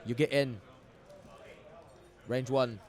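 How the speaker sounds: hammy mock Australian accent; bad Australian accent. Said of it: "That's a really bad Australian accent".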